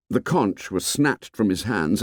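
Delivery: usual speaking voice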